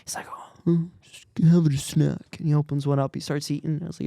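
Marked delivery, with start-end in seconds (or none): 0.3-2.4 slow, whispery stoned mumble; dazed high customer. Retold or imitated. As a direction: imitate a spaced-out guy, slow and airy.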